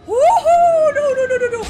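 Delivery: very high, very loud whoop into panic; falling shriek turning to panicked no's. Real, not acted. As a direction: scream as you drop. Falling in game.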